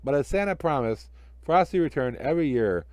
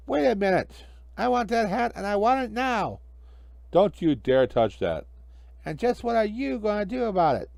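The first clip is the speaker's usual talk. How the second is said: sly magician; 9 semitones higher than usual. Acted. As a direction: gloat over the little fire. Hinkle demands hat.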